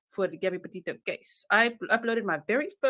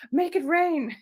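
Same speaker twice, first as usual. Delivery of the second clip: excited shout; 9 semitones higher, 5 dB louder, slower than usual. Real, not acted. Playful hype to chat.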